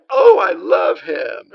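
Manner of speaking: gushing. Acted. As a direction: swoon over the guest star. Gushing over Raymond Burr.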